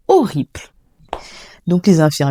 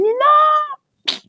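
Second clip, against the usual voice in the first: a long, very high wailed no; anguished cry; 3 dB louder than usual. Retold or imitated. Daughter learning her mother died, long anguished wail. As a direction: cry it out in despair, stretching the word. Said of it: "J'ai crié".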